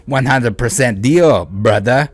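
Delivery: loud, hammed-up French cowboy accent. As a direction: boom it out as a mock French cowboy. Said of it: "whatever French cowboy comes out of my mouth".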